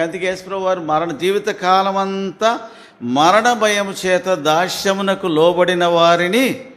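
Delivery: preaching to the congregation with mounting fire; fiery preaching. Acted. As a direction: drive the point home at full force. Preacher rises to full force.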